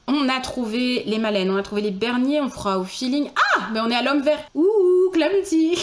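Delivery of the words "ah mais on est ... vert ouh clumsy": high, loud excited yelps; excited squeal. Real, not acted. Streamer squealing at chat's green man pick. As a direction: burst out with delight.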